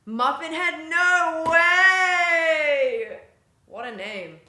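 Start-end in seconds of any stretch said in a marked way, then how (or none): 0.1-3.2 real excited yell, very loud and high; excited shout. Real, not acted. Streamer spotting a viewer in chat, excited shout. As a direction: shout it in amazed delight, stretching the end.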